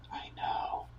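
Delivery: hushed whisper, slow and low. Retold or imitated. Comic telling a heckler to shut up, in a slow whisper. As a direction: lean in and hiss it under your breath.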